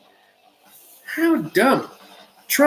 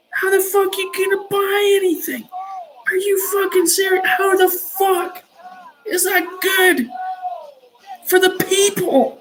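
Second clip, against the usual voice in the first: much higher, yelling in disbelief; angry shout; 11 semitones higher than usual. Real, not acted. Ranting host yells at listeners over tariff costs, angry shout. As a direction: shout it out, furious at the policy.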